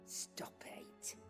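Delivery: soft embarrassed whisper. Acted. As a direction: hush it away under breath. Brushes off a compliment.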